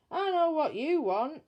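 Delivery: high and loud, knowing and fed up; exasperated burglar. Acted. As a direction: announce it with a groan of resignation. Bill guesses baby needs changing.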